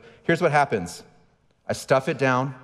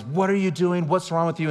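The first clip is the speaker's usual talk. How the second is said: mock exasperated scolding, pitched up; raised-pitch dad's reprimand; retold parental outburst; 6 semitones higher than usual. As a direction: imitate a dad snapping at his kid. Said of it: "my immediate response is".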